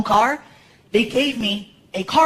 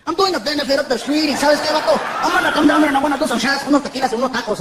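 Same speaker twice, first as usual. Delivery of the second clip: lightly voiced fellow comic, pitched higher; a hint of a higher Chicano-comic voice; Paul Rodriguez; 4 semitones higher than usual. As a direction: talk like a keen pal phoning with plans. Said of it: "He calls up the comic strip".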